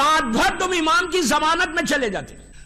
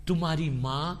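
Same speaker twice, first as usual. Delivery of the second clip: hushed sorrowful telling; 13 semitones lower, 9 dB quieter than usual. A narrator's reading. Parents begging at the door.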